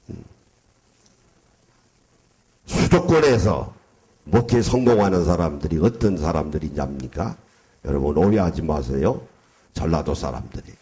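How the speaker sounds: usual speaking voice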